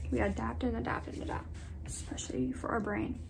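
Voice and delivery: soft inspirational whisper. Acted. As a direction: breathe it like a mantra.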